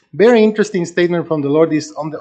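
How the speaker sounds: usual speaking voice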